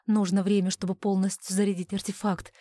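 ordinary delivery, nothing marked

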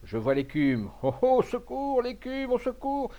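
high-pitched frightened call for help; terrified, voice leaping very high; panicked cry. A narrator's reading. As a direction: shriek for rescue from a mad dog. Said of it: "s'écria monsieur Bernard, devenu tout pâle".

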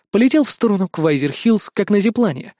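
usual speaking voice

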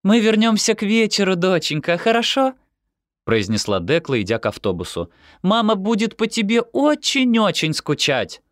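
high sing-out mother's farewell; doting Dekla. Acted. Dekla leaves her daughter for the bus, doting. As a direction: coo a goodbye in a very high voice.

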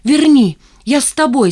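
высоко и громко, с напором; крикни, просясь пойти следом; взволнованный оклик. Acted. Ленка бежит за Димкой. (громко, порывисто)

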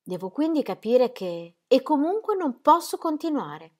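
più alta, decisa e protestante; si oppone con fermezza. Acted. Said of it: "affermò con aria di protesta". (la moglie protesta con il marito, voce alta)

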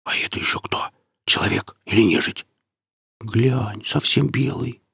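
hushed, wary whisper. A narrator's reading. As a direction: whisper it to the one beside you. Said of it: "Марек услышал чей-то шёпот".

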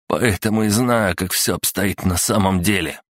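ordinary delivery

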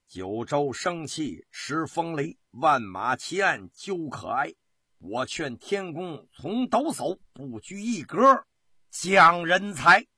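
slow, declaimed verse building to a loud finish; poem recitation. A narrator's reading. Narrator recites Gong Zizhen's famous verse, slow rising declamation. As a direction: recite it grandly, stretching the final words. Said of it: "不朽的诗句".

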